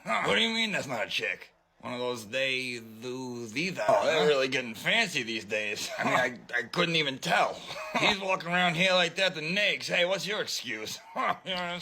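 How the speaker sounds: low, sly come-on voice; sleazy flirt. Pretend. Flirting with a newcomer, low sly come-on. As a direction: lean in and hit on them, low and oily.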